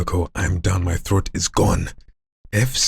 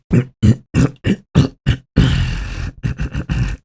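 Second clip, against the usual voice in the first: strained rasping croak; high raspy throat growl; 6 semitones higher, 3 dB louder than usual. Shown as a demonstration. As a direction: scrape the throat harshly.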